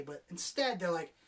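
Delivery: normal talking voice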